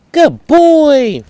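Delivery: high, loud happy praise; praising the dog. Real, not acted. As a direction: lift the voice high to reward him.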